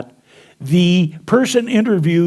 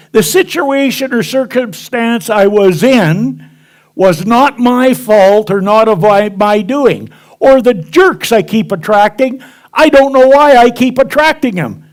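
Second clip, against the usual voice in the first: mock excuse-making; 7 semitones higher, 6 dB louder than usual. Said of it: "Has anybody ever said that?".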